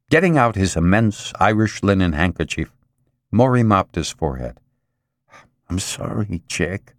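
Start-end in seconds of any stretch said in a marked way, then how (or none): 5.7-6.9 softly anxious. A narrator's reading. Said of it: "He eyed his employee anxiously".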